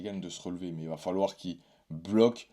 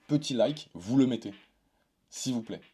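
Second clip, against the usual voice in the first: exasperated plea; 5 semitones higher, 5 dB louder than usual. Pretend. Pleading with viewers for a like.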